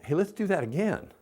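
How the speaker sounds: put-on high, bright coaxing to a toddler; upbeat invitation to try again; cheerful mom voice. Shown as a demonstration. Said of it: "You're gonna count to five".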